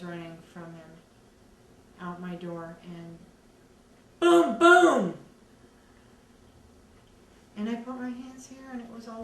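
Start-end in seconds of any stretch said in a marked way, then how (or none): 4.2-5.1 two loud, higher booms; imitated gunshots. Survivor imitating the shots that hit her, loud booms. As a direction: shout out the gun blasts.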